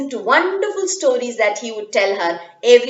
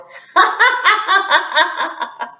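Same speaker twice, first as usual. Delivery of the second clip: higher, louder laughing out loud; hearty laugh; 8 semitones higher, 4 dB louder than usual. Acted. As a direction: laugh heartily at Minna's fright. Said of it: "grandparents laughed out aloud".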